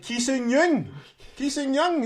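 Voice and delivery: high, loud cry of the footballer's name; mock call-out. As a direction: shout the name like a fan. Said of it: "I was like".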